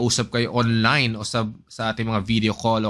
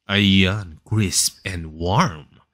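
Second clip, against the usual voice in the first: lower, slow bedroom voice; 3 semitones lower, much slower than usual. Shown as a demonstration. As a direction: sink low and linger on the words. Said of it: "pang bedroom voice". (mababa at mabagal na boses pangkuwarto)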